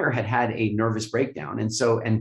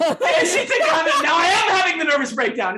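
high, loud, words through real laughter; laughing; 5 dB louder than usual. Real, not acted. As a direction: laugh at how absurd it was. Laughing while telling.

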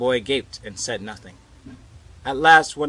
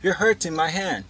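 put-on high, louder girlish complaint; girl's protest; 7 semitones higher, 6 dB louder than usual. A narrator's reading. Girl whose hand hurts, protesting to boy. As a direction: protest in a raised young voice.

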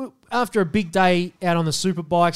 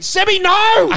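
high, loud mock yell of alarm; shouting at a mate to stop; retold shout; 10 dB louder, slower than usual. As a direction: yell his name to stop him, much higher. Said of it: "I was like".

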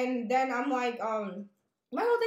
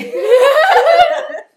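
bursting into laughter; 14 semitones higher, 20 dB louder than usual. Real, not acted. Retelling her question to him, cracking up laughing. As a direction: question dissolves into a loud high laugh.

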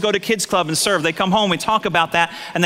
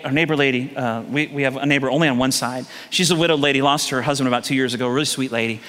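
ordinary talk

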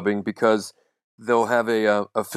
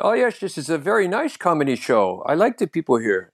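higher, lightly exaggerated Dutch accent; 5 semitones higher than usual. Shown as a demonstration. Comic showing off a Dutch comedy-show reaction, Dutch accent. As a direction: play a Dutch English speaker. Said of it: "a little bit like a Dutch accent".

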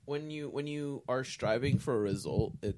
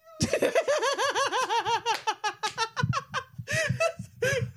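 loud laugh; 8 dB louder than usual. Real, not acted. Big laugh.

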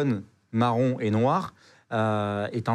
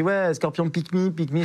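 bad French-accented English; 6 semitones higher, slower than usual. Telling the operator he was stung, in laughing French-accented broken English. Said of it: "Et je parle anglais".

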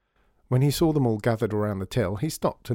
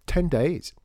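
triumphant; 6 semitones higher, 4 dB louder than usual. Acted. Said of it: "she added triumphantly".

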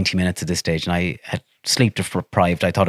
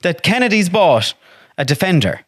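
excited retelling; 11 semitones higher, slower than usual. Real, not acted.

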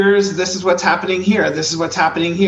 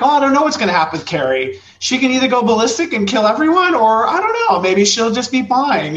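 higher, airy musing, rushed at the start; mock clueless writer; 7 semitones higher, 3 dB louder than usual. Author unsure how Carrie ends, playfully clueless musing. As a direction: shrug through the options like anything goes. Imitating Stephen King writing Carrie.

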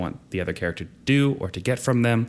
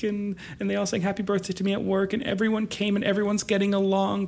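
lightly voiced emotional friend, pitched up; tearful happy friend; 12 semitones higher than usual. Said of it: "She's like".

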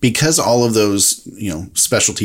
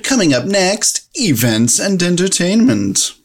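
announcer voice; 9 semitones higher than usual. Acted. Announcer segment intro.

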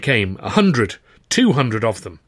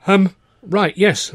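higher, flustered start; stuttered hesitation; 8 semitones higher, 5 dB louder, much slower than usual. A narrator's reading. Flustered young visitor answers busy manager, stuttering. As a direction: stumble nervously into an answer. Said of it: "I stuttered".

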